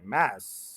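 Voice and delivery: मैस hit hard and low; full stress. Shown as a demonstration. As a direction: punch मैस with full energy. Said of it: "पूरी energy से बोलें".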